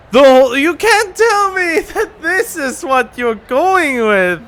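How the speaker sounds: laughing in disbelief; incredulous laugh through words. Real, not acted. Laughs at the plot twist.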